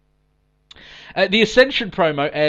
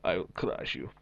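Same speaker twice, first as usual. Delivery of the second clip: put-on Russian accent; 7 semitones lower, 10 dB quieter than usual. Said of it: "Ivan Drago from Rocky".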